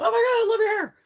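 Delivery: high-pitched imitation of an excited compliment; gushing admirer. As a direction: gush like a thrilled stranger.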